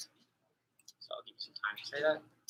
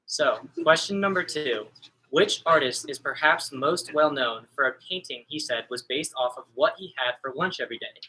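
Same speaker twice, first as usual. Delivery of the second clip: louder, announcing quiz-host read; 6 dB louder than usual. Acted. Trivia host announcing the question to the teams, projected and clear.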